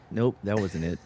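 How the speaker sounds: normal talking voice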